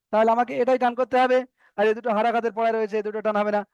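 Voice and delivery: ordinary talk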